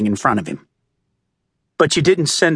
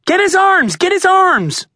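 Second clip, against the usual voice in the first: much higher, louder commanding yell; barking orders; 4 dB louder than usual. A narrator's reading. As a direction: shout orders at fellow cops. Cops rushing the father.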